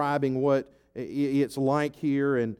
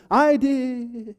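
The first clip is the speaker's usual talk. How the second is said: boastful frog; 6 semitones higher than usual. Acted.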